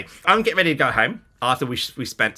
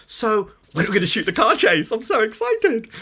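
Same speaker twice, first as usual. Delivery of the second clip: bright and rising, much higher; eager excitement; 9 semitones higher, 4 dB louder than usual. Retold or imitated. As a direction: gush like a kid before the big day. Eager newcomer asks about shoot.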